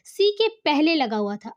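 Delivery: ordinary delivery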